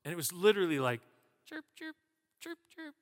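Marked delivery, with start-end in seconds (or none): 1.5-3.0 high, soft imitated cricket chirps. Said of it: "All you could hear was crickets".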